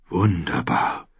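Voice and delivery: slow lordly pronouncement; announcing lord. A narrator's reading. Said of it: "verkündete Mattis Rowan".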